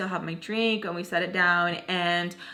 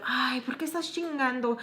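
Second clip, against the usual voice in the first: higher-pitched mock complaint in Spanish; retold annoyance; 4 semitones higher, 3 dB quieter than usual. Baltimore complaining as she comes out, imitated annoyance. Retold quarrel between two women.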